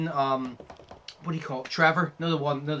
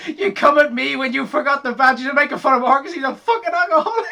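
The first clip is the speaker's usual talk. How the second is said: higher, louder rant breaking into laughs; laughing mock rant; 10 semitones higher, 7 dB louder than usual. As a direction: argue it while laughing. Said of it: "It's like".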